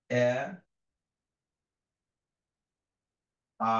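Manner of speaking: each vowel said alone, slowly, with long pauses; vowel series demo. Shown as a demonstration. Teacher spelling out the vowel chart, one sound at a time. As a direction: model each vowel clearly for the class. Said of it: "সিরিয়ালটি কিভাবে লেখা হয়েছে".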